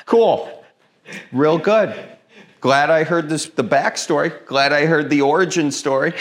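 high, loud sarcastic "cool". Retold or imitated. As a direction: fake enthusiasm, dripping sarcasm. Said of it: "like my grandma telling a story".

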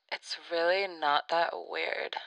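thin telephone-filtered commentary; weird telephone voice. Pretend. Voice commenting on fasting land, telephone sound. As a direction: interject as a voice down a phone line. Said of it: "telephone vocal voice".

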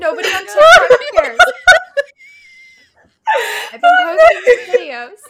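high, loud, laughing through the words; crying with laughter; laughing hard. Real, not acted. Helpless laughter at own story.

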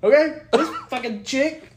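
ordinary delivery

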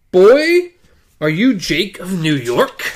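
put-on high, loud call to the boy; booming machine hailing a child; commanding hail. A narrator's reading. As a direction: call out sharply, then question him.

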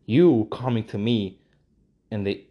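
ordinary talk, nothing marked

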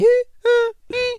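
shrieking high laugh. Real, not acted. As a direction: burst into a squealing laugh. Laughing at birthday music.

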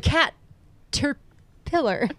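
cat, then a slow terpillar; split word. Retold or imitated. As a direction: pause hard after cat, drag out terpillar. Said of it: "said it like an insane person".

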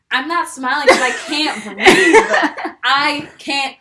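slow and laughing. Real, not acted. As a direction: laugh through the complaint. Retelling being out of breath.

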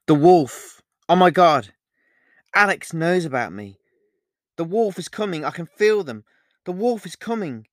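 loud, high burst of alarm; panicked outcry. Acted. Olivia realises Alex knows about the wolf, panicked. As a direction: cry out as dread rushes in.

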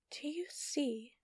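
slow, hushed whisper. Acted. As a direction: breathe the question slowly. Villain's hushed taunt.

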